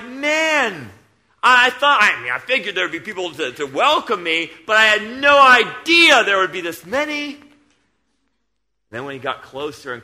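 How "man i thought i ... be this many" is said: loud, higher voice of a man amazed at the crowd; a missionary retold, staggered by the crowd. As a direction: marvel out loud at the huge welcome. Retells traveller amazed at crowd.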